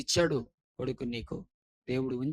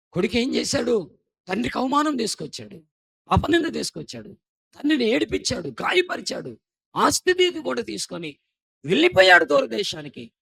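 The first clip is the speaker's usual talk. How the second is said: higher, louder preaching, building; fervent preaching; 10 semitones higher, 10 dB louder than usual. Acted. Preacher listing the son's wrongs, rising fervent preaching. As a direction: hammer each charge home.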